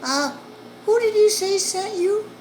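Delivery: high, loud woman asking through the door; second woman's voice. Retold or imitated. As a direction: check who sent him, suspicious. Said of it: "another female voice comes on".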